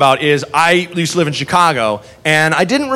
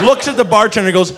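ordinary talk

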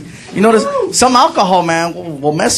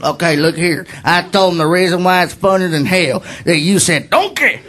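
low put-on fan voice; cool guy; 3 semitones lower than usual. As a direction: play the laid-back fan, pitched down. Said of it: "guy walks over real cool".

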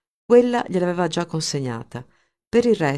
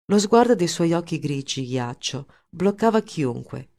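usual speaking voice

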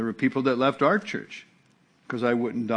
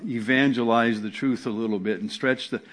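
ordinary talk, nothing marked

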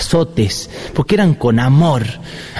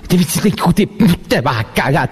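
mom's threat; 5 semitones higher than usual. Retold or imitated. Mother warns kid from the street, lightly voiced threat. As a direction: mildly mimic mom promising punishment. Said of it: "te decía".

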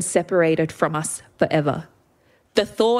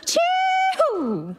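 very high party whoop; shouted whoop. A narrator's reading. Aunts rushing the dancers like a rugby team, shouted whoop. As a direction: whoop it high, sliding down at the end.

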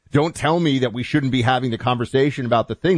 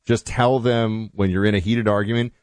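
ordinary delivery

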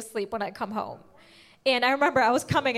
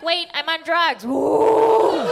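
gleeful whoop; 3 semitones higher, 4 dB louder, much slower than usual. Retold or imitated. Faking relief, whooping.

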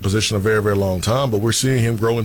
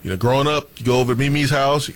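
normal talking voice

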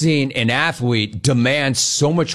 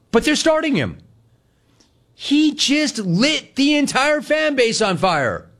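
outraged; 11 semitones higher, slower than usual. Real, not acted. Outraged at team starting player.

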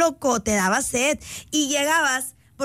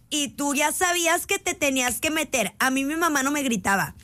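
ordinary delivery

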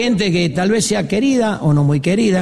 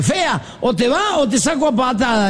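higher, mock-tough drunk voice; drunk's threat; 6 semitones higher than usual. Drunk addresses the homely girl, higher mock-threatening voice. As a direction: bluster a comic threat, pitched up. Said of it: "Seremo dice".

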